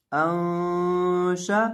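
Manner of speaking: अंशा chanted very slowly with a nasal hum on the noon; drawn-out recitation. Shown as a demonstration. Teacher reciting the joined syllables for students, slow chanted ghunna. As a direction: hold the noon of अंशा in a long sung ghunna.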